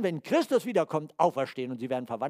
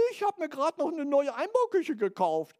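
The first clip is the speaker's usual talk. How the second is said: a lightly voiced old lady, higher and bright with pride; old woman quoted; 10 semitones higher, 3 dB louder than usual. Old woman bragging about her new kitchen to the preacher, lightly voiced higher. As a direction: play the proud old neighbour, pitched up. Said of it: "Ging eine Frau bei uns vorbei".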